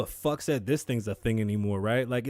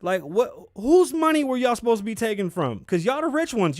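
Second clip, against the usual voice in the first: ordinary talk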